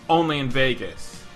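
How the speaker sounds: usual speaking voice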